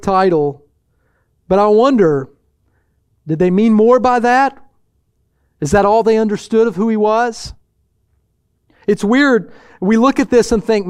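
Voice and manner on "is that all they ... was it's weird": ordinary talk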